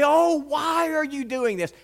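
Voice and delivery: put-on high, whining kid's complaint; whiny child. Boy complains to his parents, high whine. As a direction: whine it like a hurt seven-year-old.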